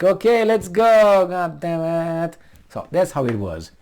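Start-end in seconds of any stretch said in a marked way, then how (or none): 0.0-2.4 a hint of grudging, slow reluctance; reluctant. Mocking reluctant countries forced into war, grudging and slow. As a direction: drag it out like a reluctant ally. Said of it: "They were like".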